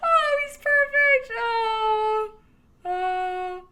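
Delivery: very high, drawn-out gushing; delighted squeal. Real, not acted. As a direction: squeal with adoration, easing off in volume. Delight at seeing a character.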